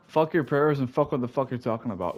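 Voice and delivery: normal talking voice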